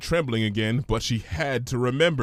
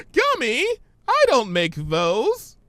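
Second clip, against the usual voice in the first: higher, giggly and louder; laughing Pinky; 13 semitones higher, 4 dB louder than usual. Acted. Pinky laughing to Gummy, high and giggly. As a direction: speak through giggles. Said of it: "shoulders bouncing with laughter".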